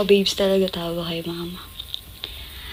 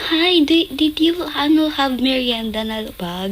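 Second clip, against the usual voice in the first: lightly voiced konyo rich-mom Taglish, higher; a hint of a sweet rich mom offering snacks; 6 semitones higher than usual. Rich mom of the neighbor kid offering merienda, mild konyo lilt. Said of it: "Ganun magsalita. Konyo".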